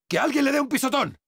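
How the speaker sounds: quick, high cry for help; alarmed call. Acted. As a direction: urge someone to squash it, fast. Alarmed at jumping bug.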